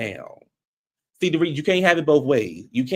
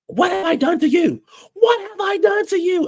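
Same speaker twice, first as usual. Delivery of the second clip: high, louder mock yell; imitated yell; 5 dB louder than usual. Vlogger imitating Sutton yelling at Dorit, high mock shout. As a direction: shriek "what have I done to you" like Sutton. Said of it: "Sutton starts yelling".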